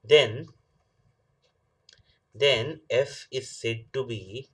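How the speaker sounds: slow dictation with long pauses. Real, not acted. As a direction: dictate it slowly, phrase by phrase. Dictates definition while writing. (धीरे-धीरे लिखवाते हुए)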